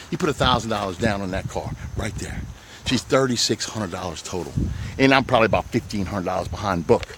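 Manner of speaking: hushed sales confidence over an unbelievable price; hushed near-whisper. Real, not acted. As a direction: drop low and soft like sharing a secret. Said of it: "I'm whispering".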